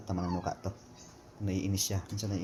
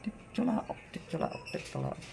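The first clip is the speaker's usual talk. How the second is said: whispered crow; 8 semitones lower, 9 dB quieter than usual. Pretend. Softly crowing to the chicken.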